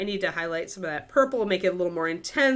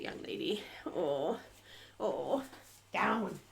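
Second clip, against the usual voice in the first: whispered scolding; 13 dB quieter, much slower than usual. Real, not acted. Scolding the dog softly.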